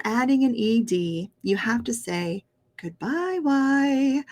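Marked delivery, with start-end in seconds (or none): 2.8-4.2 put-on high, singsong farewell for children; teaching kids. Shown as a demonstration. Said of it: "I like to say that when I'm teaching kids".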